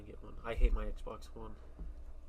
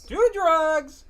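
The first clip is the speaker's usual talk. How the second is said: shouting; 14 dB louder than usual. Pretend. Shouted joke.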